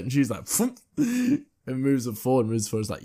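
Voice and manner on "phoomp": high, punchy lifting sound; heavy thud noise. Retold or imitated. Aunt heaving the cabinet, high punchy mimicked thud. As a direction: make the sound of a heavy lift.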